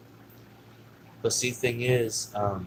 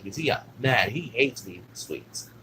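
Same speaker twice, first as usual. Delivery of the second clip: usual speaking voice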